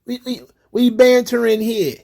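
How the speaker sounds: lightly voiced "we bantering" mock. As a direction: play the unbothered joker. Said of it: "Come on, it's jokes".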